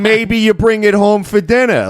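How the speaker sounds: put-on hint at stolen goods in an Italian-American accent; dad's Italian-American voice. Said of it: "then it would be like".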